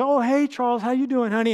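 cheerfully imitating mom answering the phone; a hint of mom picking up the phone, happy to hear her son; mom's greeting. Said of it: "and she'd say".

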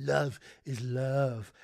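slow, drawn-out creepy voice; weird Magnotta voice. Retold or imitated. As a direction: stretch every word out slowly and strangely. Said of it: "that weird fucking voice like Magnotta".

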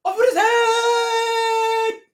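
very high, loud, stretched-out scream; hammed-up queen's shriek. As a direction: screech it out as an imperious queen. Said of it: "She's like".

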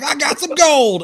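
very high, loud, drawn-out mock holler; whooping gold-strike cry; freaking out. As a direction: imitate an overjoyed prospector hollering. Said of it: "freaking out".

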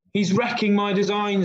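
high-pitched storybook screech; mildly acted screech of protest; mock screech. A narrator's reading. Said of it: "screeched Henry".

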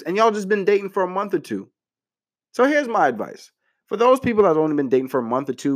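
ordinary talk, nothing marked

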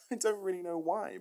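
high, loud, through laughter; laughing. Real, not acted. Vlogger amused by the villain's puzzling choice, laughing high and loud. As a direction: say it while cracking up. Laughing while commenting on the plot.

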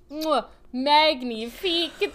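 French chef's kiss, slow and savoring. Acted. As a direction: kiss the fingers like a proud French chef. Chef's kiss over cake.

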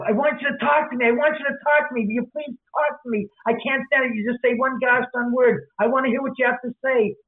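high-pitched, exasperated begging; imitated pleading. TV character begs a gorgeous girl to talk, acted pleading.

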